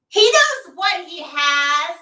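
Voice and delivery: high, slow, put-on girly voice mocking her; mock ditzy woman. Comedian retells the woman in the front row, mocking high voice.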